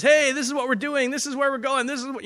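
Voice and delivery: high-pitched excited quote; fired up. Retold or imitated. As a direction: imitate a friend bursting to share the plan. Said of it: "he just got so fired up".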